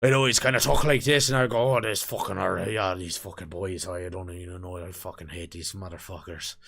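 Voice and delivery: cursing Irish dad impression; Irish accent. Shown as a demonstration. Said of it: "do an Irish accent was if I impersonated my dad".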